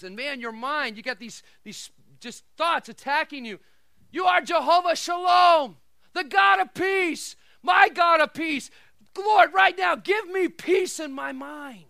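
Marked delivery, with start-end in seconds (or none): 4.1-11.8 loud, high preacher's prayer; full-force impassioned plea; shouted fervent prayer. Acted. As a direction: cry out to God, slowing on the phrases.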